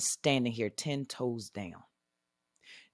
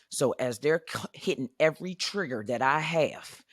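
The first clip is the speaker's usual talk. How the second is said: normal talking voice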